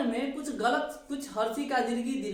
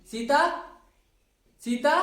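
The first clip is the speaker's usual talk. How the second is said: Sita said louder, with a rise; Sita said louder with a rising tune; emphatic syllable drill; 3 semitones higher, 5 dB louder than usual. Shown as a demonstration. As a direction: call out Sita loud for repetition. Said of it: "बोलिए मेरे साथ".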